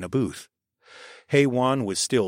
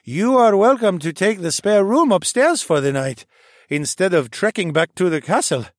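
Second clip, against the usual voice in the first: higher, louder tipsy slurring voice; drunken slur; 9 semitones higher, 6 dB louder than usual. A narrator's reading. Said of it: "Fareed slurred".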